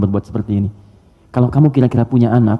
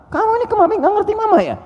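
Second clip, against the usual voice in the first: much higher put-on scolding mom voice; scolding mother. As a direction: scold the child like a nagging mother. Ibu memarahi anak. (ibu yang memarahi anak dengan suara tinggi)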